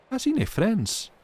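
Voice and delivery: tearful voice. Acted. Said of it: "said she in a tearful voice".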